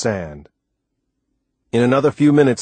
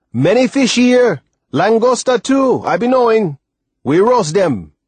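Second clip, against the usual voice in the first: excited shout; 12 semitones higher, 6 dB louder than usual. A narrator's reading. Sailor shouts up from the water to the boy, excited. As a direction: call the good news up the beach. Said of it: "Timothy shouted up from the water".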